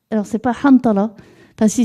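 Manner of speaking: ordinary talk, nothing marked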